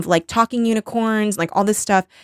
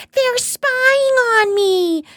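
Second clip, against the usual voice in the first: put-on very high, slow, strained girl's scream; mock scream; much slower than usual. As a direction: shriek it like a possessed girl. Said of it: "she starts screaming".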